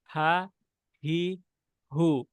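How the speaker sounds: "হা, হি, হু" soft, letting the air flow; softly, with breath. Shown as a demonstration. Teacher showing hams letters, "হা, হি, হু" soft and airy. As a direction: say "হা, হি, হু" lightly, focused on the breath. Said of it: "দেখেন খুব softly".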